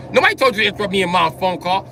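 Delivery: high, fast indignant rant; angry rant. Retold or imitated. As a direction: scold her fast and hard. Ranting at sister on phone.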